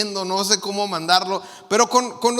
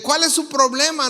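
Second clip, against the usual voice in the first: acted irritation, raised pitch; annoyed; 6 semitones higher, 3 dB louder than usual. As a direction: demand it like an offended texter. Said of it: "se ve que está enojado". (enojado, tono agudo)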